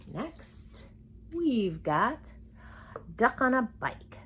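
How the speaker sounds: ordinary delivery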